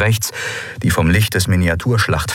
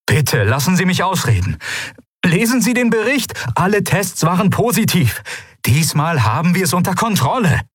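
desperate pleading; 10 semitones higher than usual. A narrator's reading.

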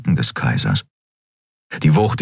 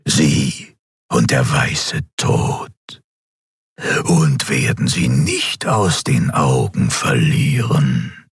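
slow, low dark emperor voice; deliberate, menacing overlord in a deep register; dark emperor, brooding; 5 semitones lower, slower than usual. Acted. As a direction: draw the words out low, like a patient shadowy tyrant.

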